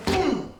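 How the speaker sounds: sudden high boom; punchy vocal effect; imitated impact. Shown as a demonstration. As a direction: sound out the strike landing. Imitates the demonstrated hit.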